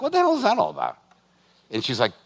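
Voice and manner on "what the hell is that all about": mock exasperated self. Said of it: "I was like".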